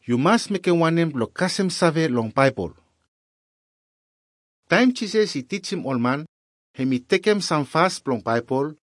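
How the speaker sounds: usual speaking voice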